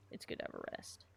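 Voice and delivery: whispered, no voice at all. Shown as a demonstration. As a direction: breathe the words out in a whisper. Repeats the line, then whispers it.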